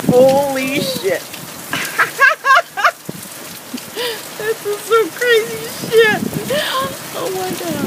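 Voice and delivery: high, giddy laughing; laughing in disbelief. Real, not acted. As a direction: laugh it out, drenched and thrilled. Soaked in the storm, laughing.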